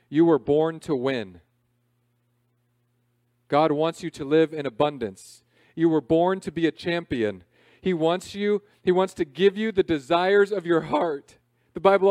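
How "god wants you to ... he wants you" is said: normal talking voice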